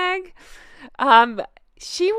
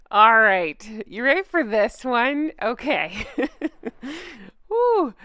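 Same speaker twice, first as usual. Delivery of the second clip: ordinary talk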